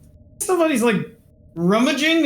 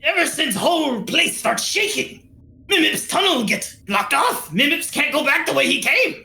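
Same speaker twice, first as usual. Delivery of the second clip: little creature merchant, higher swooping pitch; Mimips; 4 semitones higher than usual. Acted. Trapped merchant explaining how he got stuck, creature voice. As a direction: tell it in an animated critter voice.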